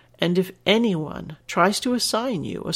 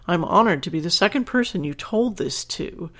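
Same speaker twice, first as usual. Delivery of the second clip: usual speaking voice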